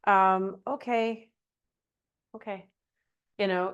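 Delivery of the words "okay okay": meek okay. Shown as a demonstration. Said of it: "It sounds like this".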